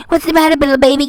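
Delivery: quick sneering singsong at a crybaby; mocking her quickly, like teasing a toddler; fast mock taunt. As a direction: rattle off the baby taunt fast. Same taunt repeated quickly.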